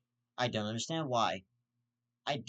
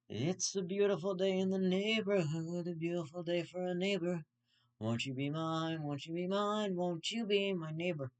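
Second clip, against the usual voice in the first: mock Mister Rogers; 6 semitones higher than usual. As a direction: croon it like a stoned children's host. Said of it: "then go".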